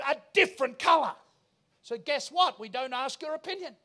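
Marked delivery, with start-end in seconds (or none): 0.0-1.1 mock crowd shout. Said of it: "You would say, all of you".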